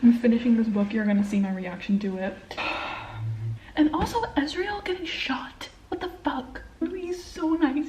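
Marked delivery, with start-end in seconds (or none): 2.4-3.7 long unvoiced sigh; breathy sigh. Real, not acted. Sigh before next thought. 3.8-7.9 high whispered dismay; whispered grief over favourite character; shocked whisper. Real, not acted. Upset about character shot.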